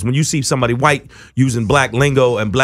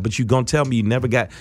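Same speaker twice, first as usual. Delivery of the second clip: ordinary talk, nothing marked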